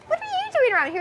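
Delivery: surprised retort to Esteban; surprised echo. Retold or imitated. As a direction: toss his question right back, amazed. Echoing back surprised.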